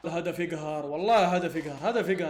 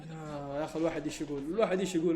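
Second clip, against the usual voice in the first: ordinary talk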